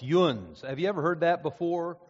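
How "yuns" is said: "yuns" said low, falling, like a Pennsylvania Dutch local. Retold or imitated. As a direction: give the home-region "yuns", low.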